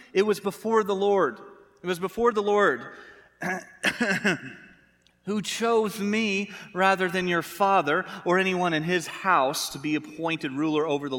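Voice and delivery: imitated indignant self-justification; defensive. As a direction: hit back as a defensive husband. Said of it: "He gets defensive".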